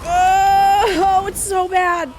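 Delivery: high, loud anguished cries that slowly subside; horrified outcry. Real, not acted. Viewer watching a brutal show scene, crying out in horror.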